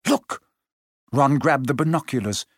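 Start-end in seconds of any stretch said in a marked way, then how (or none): 0.1-0.4 urgent cry. A narrator's reading. Hermione alarmed, pointing.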